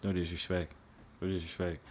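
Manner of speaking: soft mock echo.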